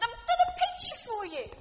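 lightly voiced little girl showing off; excited little girl. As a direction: hand it over like an eager child. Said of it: "thrust it into his hand and said".

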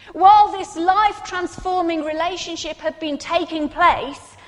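urgent pulpit appeal; impassioned preaching. Acted. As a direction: press the congregation with each question. Preacher pressing rhetorical questions.

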